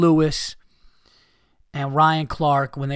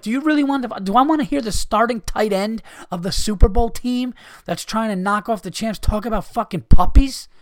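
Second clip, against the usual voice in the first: normal talking voice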